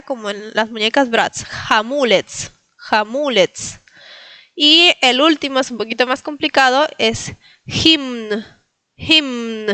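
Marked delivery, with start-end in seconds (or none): 1.4-3.8 "Jamulets" slowly, MU stressed, ending in ts; final C as TS. Shown as a demonstration. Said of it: "la C se pronuncia como T Z". 7.6-9.7 "hymn" as jimn, both m and n; all letters pronounced. Shown as a demonstration. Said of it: "se pronuncia la M y la N".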